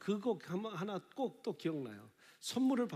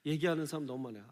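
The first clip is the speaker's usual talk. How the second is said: ordinary talk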